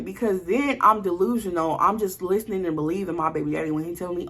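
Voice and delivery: ordinary talk, nothing marked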